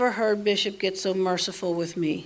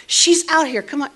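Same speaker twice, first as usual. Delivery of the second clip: louder, higher and insistent; 5 semitones higher, 4 dB louder than usual. Retold or imitated. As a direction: press him, she's right there. Husband insisting bird is back.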